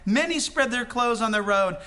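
preacher's full-voiced Bible reading; preaching scripture reading. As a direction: proclaim it to the congregation.

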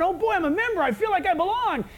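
high, eager, thrilled new member; giddy excitement. Retold or imitated. New church member gushing about joining, high and giddy. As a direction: gush like you just got in.

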